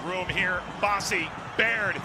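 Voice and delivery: normal talking voice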